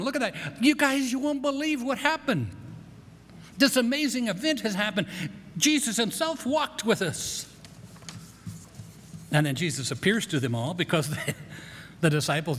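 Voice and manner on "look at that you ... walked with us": higher-pitched, amazed eyewitness report; raised pitch, eager and amazed; excited news. Acted. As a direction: break astonishing news to your friends. Cleopas excitedly tells disciples the news.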